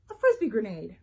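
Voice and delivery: usual speaking voice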